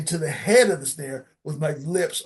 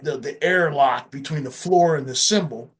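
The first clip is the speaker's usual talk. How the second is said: ordinary talk, nothing marked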